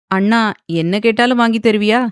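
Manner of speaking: usual speaking voice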